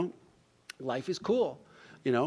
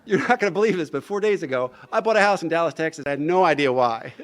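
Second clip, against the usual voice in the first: laughing; 3 semitones higher, 9 dB louder than usual. Real, not acted. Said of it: "So I started laughing".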